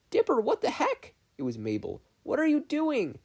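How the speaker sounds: high, loud alarmed cry; shocked shout. Acted. Sister yelling at her brother in shock, high alarmed cry. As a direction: yell at him in disbelief.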